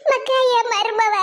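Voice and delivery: slow, drawn-out lament; tearful wail. Acted. Grandmother mourning to Sudha over her daughter-in-law, tearful wail. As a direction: wail it, stretching the final word.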